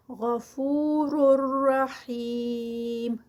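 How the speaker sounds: slow, drawn-out tajweed recitation; Quranic recitation. Shown as a demonstration. Teacher reciting the verse for students, slow and melodic. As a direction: chant each word slowly, stretching the vowels.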